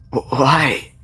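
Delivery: choked, breaking question. Acted. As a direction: force it out through a choking throat. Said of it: "he choked".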